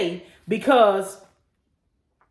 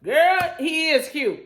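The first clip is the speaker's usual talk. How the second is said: high, loud squeal of delight; mock swooning; 11 semitones higher, 5 dB louder, much slower than usual. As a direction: gush like a giddy girlfriend. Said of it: "you be like".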